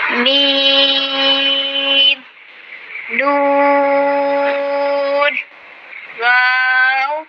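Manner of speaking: میم, نون and واو each held long; drawn-out letters. Shown as a demonstration. Teacher reciting the alphabet board for students, slow. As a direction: stretch every letter name slowly. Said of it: "پوری تختی سنانی ہے".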